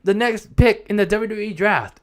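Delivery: high-pitched mock announcer; Stephanie McMahon. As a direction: proclaim it like a big stage reveal. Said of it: "Stephanie McMahon comes out and it's like".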